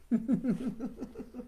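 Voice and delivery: light, bubbling little laugh; chuckle. Real, not acted. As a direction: giggle at the joke in the story. Reader chuckles at the pig.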